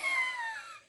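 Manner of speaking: high shriek of laughter. Real, not acted. Reader shrieking with laughter at viewers. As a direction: let a sudden squeal of laughter out.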